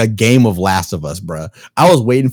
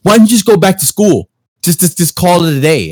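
scolding dad; 6 semitones higher, 5 dB louder than usual. Retold or imitated. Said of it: "He's like".

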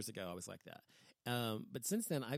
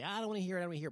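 pitched-up, louder mock whine of someone plugging their ears; mocking whiny refusal; 7 semitones higher, 6 dB louder, much faster than usual. Mocking people who avoid the news, higher and louder whine. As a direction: sneer it like a sulky kid refusing to listen.

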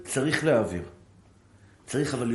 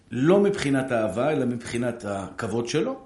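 normal talking voice

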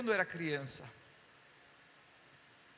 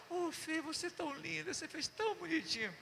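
higher and softer, tender; sweet praise; 7 semitones higher, 8 dB quieter than usual. Retold or imitated. André praising his wife Fernanda tenderly. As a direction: coo it gently like praising a loved one.

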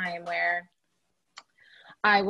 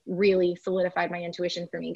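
ordinary delivery

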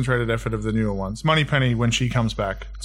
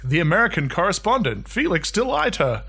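mock announcer; 8 semitones higher than usual. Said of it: "he's just kind of like".

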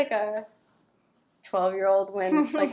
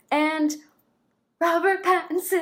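excited announcement; 8 semitones higher, 7 dB louder than usual. Real, not acted. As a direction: sing out the name like a big reveal. Announcing a favourite from the list.

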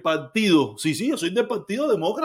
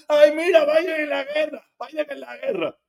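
mock cheer; 8 semitones higher than usual. Mocking Trump fans cheering war.

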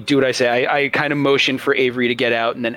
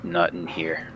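grim refusal; 6 semitones lower, 8 dB quieter than usual. Acted. Character refuses in tense scene.

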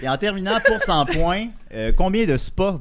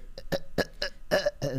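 short breathy repeated puffs; imitated game character; 2 semitones higher, 7 dB quieter than usual. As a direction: imitate the stuck little character in jerky bursts. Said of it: "le bonhomme fait". (petits souffles saccadés imités)

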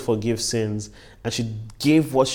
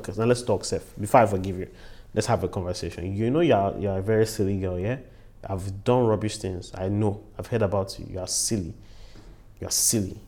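lower, quicker imagined telling-off; mock scolding; 4 semitones lower, 4 dB quieter, faster than usual. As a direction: act out a low-key lecture to a silly girl. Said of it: "You even wanted Jesus to, to say".

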